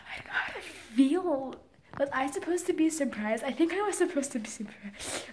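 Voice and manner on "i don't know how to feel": voiceless and bewildered; whisper. Real, not acted. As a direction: whisper it, at a loss.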